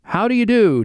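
high called-out greeting; shouting a greeting. Retold or imitated. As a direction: call it out over the singing. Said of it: "interrupts the singing to shout".

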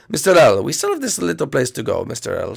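normal talking voice

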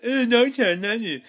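high, loud swooning mockery; mock lovesick Rory. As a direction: ham up a moony declaration. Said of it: "Amy's like".

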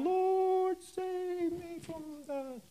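high, slowing desperate cry of Lord, save me; cry for help. Acted. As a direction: plead like a man sinking in the sea. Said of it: "he cried out".

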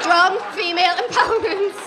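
emphatic slogan. Acted. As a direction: proclaim it proudly to the crowd. Repeats slogan after audience laugh.

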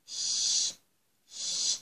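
"ش" as a long breathy unvoiced sh hiss; the sh sound. Shown as a demonstration. Teacher showing children the "ش" sound of C and H. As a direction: push out a soft "ش" with only breath. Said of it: "بيدونا ساوند ايه؟".

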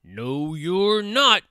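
troll refusal rising to loud. A narrator's reading. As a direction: swell to a loud, high troll "not". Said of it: "said the troll".

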